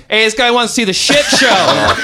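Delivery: high, loud laughing shout; loud call out. Retold or imitated. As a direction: yell it across the store. Friend shouts to bloody guy.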